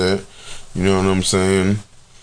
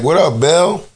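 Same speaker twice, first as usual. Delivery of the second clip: casual bear greeting; 7 semitones higher than usual. Retold or imitated. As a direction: greet him like an old buddy.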